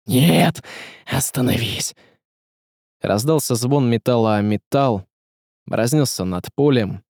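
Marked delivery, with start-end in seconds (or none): 0.1-1.9 desperate cry. A narrator's reading. Казимир begs the smith to stop, strained desperate cry. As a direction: plead for it to stop. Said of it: "закричал Казимир".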